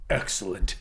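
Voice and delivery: low, quiet, smug villain; Grim Knight, smug. Retold or imitated. Grim Knight watching his robots fall, arms crossed: low smug villain. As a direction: drop low and purr it with smug satisfaction. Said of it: "standing up there with his arms crossed like".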